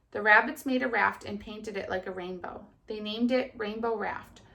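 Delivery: put-on lively storybook reading to children; kindergarten teacher voice. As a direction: read it brightly to little kids. Teacher reads story aloud.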